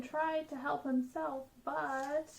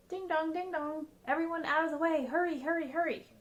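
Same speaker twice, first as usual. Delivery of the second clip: louder and fast, clearing the way; urgent shouting; 5 semitones higher, 3 dB louder, faster than usual. Acted. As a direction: firemen yelling as they race out. Firemen racing to the fire.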